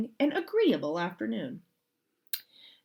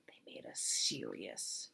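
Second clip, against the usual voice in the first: lower, soft hushed whisper; suspense whisper; 10 semitones lower, 14 dB quieter than usual. A narrator's reading. Reader warning the child of trouble, whispered.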